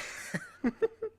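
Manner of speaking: high, helpless giggle; laugh. Real, not acted. Vlogger recalling mocking the vice principal, giggling. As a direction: crack up mid-story.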